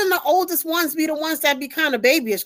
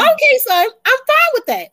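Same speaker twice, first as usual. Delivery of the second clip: higher, louder mock mother's reply; 7 semitones higher, 4 dB louder than usual. Mother voices herself agreeing to son's plan, higher and louder. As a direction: play the agreeable mom.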